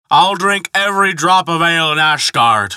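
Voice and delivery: high, slurred giant's brag; drunken boast. A narrator's reading. Giant boasting to the gods over ale, drunken.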